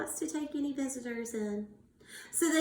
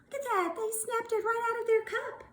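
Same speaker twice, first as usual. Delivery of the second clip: high, quick excited look; excited pointing; 11 semitones higher, faster than usual. Real, not acted. Teacher to class, an excited look at that. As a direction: point it out with delight. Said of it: "showing picture excitedly".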